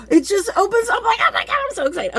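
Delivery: high, loud squeal of delight; excited yell. Real, not acted. Unboxing a gift for viewers, squealing with delight. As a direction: squeal it out, thrilled. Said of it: "I didn't mean to yell".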